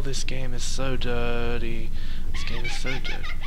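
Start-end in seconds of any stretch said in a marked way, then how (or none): 2.3-3.3 laughing squeal. Real, not acted. Repeats line laughing, high voice.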